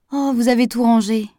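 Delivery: happy discovery on a lifted voice; cheerful exclamation. A narrator's reading. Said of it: "s'exclama-t-elle gaiement".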